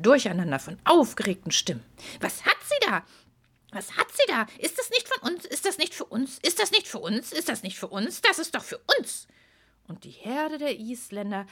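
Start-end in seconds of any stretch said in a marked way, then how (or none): 2.2-9.3 high, clamouring horse voices; greedy herd all talking at once; excited horses. Acted.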